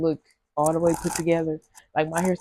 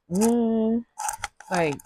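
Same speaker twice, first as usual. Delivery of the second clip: normal talking voice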